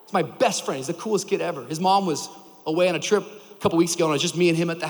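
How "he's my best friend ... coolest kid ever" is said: ordinary delivery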